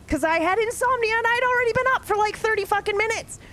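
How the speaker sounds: high, fast insistence; emphatic. Real, not acted. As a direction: insist urgently. Insisting he was awake.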